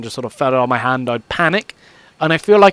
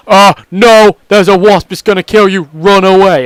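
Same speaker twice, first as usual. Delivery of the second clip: louder, alarmed, urgent warning; panicked alarm; 3 semitones higher, 8 dB louder than usual. Retold or imitated. Brain yells at [name] to run, loud panicked alarm. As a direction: brain screaming to run from the wasp. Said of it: "my brain was like".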